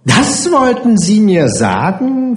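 high, slow, sneering question; mock them with a drawn-out question; höhnisch. A narrator's reading. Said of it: "fügte sie höhnisch hinzu". (spöttisch gedehnt, mit hoher Stimme)

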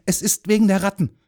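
put-on high, fast, urgent boy; quick. A narrator's reading. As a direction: blurt out the secret. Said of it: "sagte Jürgen da schnell".

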